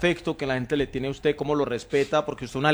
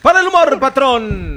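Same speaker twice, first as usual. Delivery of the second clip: loud, high-pitched holler; showman's yell; 10 semitones higher, 10 dB louder than usual. Acted. Host cues the humour segment to his boss, hollered shout. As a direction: holler it high at full volume. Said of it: "pero grítele en la iglesia".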